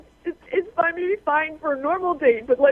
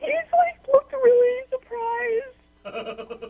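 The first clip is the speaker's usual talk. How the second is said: very high, slow tearful squeak; high wail stretched over the words; crying; 10 semitones higher, much slower than usual. Real, not acted. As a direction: drag the words out through tears. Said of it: "you crying about the situation".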